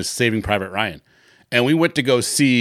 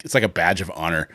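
ordinary talk, nothing marked

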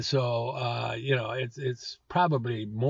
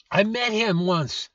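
ordinary talk, nothing marked

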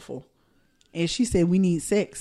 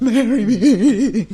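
slow, loud, drawn-out marry me with a laugh; laughing over-the-top proposal; mock proposal; 2 semitones higher, 10 dB louder, much slower than usual. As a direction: ham up a big proposal. Mock proposal after heifer joke.

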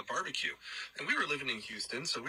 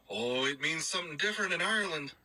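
higher-pitched Irish voice; Irish accent; 5 semitones higher than usual. Retold or imitated. Roommate explains the word in Ireland, Irish accent. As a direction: imitate an Irishman realising something, pitched up. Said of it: "pretty good job with that accent".

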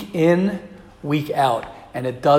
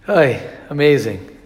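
ordinary delivery

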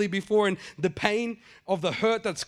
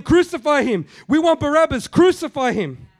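loud mob cry, pitched higher; the Friday mob shouting for Barabbas, loud and high; angry mob; 8 semitones higher, 7 dB louder than usual. Retold or imitated. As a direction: demand it like the crowd on Good Friday. Mob demanding crucifixion.

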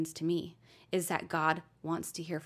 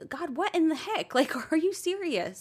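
higher, incredulous mock outburst with a laugh; angry at God; 10 semitones higher, 4 dB louder than usual. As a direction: cry out to God in half-laughing indignation. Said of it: "If you're feeling angry".

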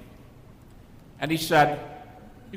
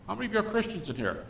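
quick, higher mocking professor; 7 semitones higher, faster than usual. A hint of a professor needling his class. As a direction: lightly voice the smug professor. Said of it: "goes like this".

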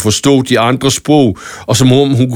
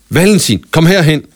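higher calling voice; Lærke calls Valentin to her side, raised and higher; 6 semitones higher than usual. Acted. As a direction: call him over across the battle. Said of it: "kaldte Lærke på nissesprog".

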